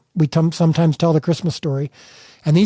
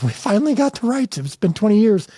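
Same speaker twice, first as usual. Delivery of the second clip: joyful retelling; 6 semitones higher than usual. Retold or imitated. Telling wife they got rights.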